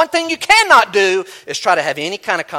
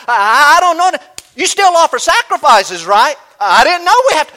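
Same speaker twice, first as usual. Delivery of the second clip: flustered believer; 9 semitones higher, 9 dB louder than usual. Retold or imitated. Preacher voices a flustered believer.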